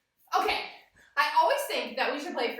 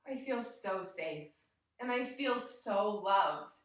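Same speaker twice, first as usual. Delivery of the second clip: soft, slow, lowered voice; tender calm; 4 semitones lower, 5 dB quieter, slower than usual. Real, not acted. Teacher to kids after the mantra, tender and calm. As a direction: savour it softly and slowly.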